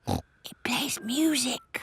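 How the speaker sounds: slow whisper. Acted. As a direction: whisper it slowly. Whispering in the quiet clubhouse.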